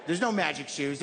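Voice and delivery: normal talking voice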